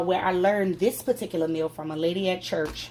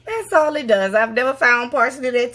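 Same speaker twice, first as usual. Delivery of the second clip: laughing loudly; 6 semitones higher, 8 dB louder than usual. Real, not acted. Laughing about parsley.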